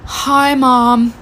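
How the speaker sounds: hammed-up sickly greeting; mock dramatic. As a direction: play up being poorly for mom. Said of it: "like, so dramatically".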